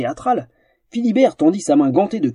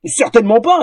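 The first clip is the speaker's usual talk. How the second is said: higher, louder outraged reply; indignant retort; 7 semitones higher, 4 dB louder than usual. Acted. Offended fencer retorts to the troll, indignant. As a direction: fire back, offended. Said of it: "rétorqua Janus outré".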